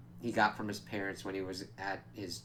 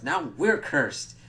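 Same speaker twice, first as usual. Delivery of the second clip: mock dismay; 5 semitones higher, 7 dB louder, much slower than usual. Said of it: "Laszlo's like".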